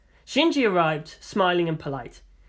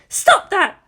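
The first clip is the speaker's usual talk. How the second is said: very high, loud shout; angry shout; 14 semitones higher, 8 dB louder than usual. A narrator's reading. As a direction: shout at the boy to stop. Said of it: "his aunt shouted".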